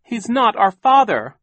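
high, loud outburst; shouted protest. A narrator's reading. Klaus denies Sham is their father, shouting. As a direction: yell the denial. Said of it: "Klaus shouted".

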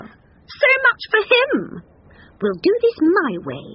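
high, drawn-out huff; put-out lady cow; dismissive cow. A narrator's reading. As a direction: write him off in a high voice. Cow dismisses cowardly husband.

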